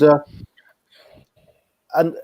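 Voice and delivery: ordinary delivery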